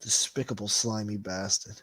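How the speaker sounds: usual speaking voice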